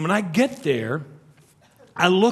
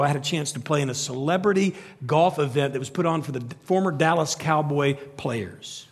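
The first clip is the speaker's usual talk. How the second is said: ordinary talk, nothing marked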